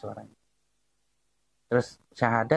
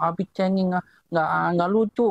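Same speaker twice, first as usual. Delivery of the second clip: higher-pitched imitation of a complaining child; protesting child; 6 semitones higher than usual. Child scolds crying dad at the grave, imitated protesting child. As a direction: imitate a kid's indignant protest. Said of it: "dia nggak terima".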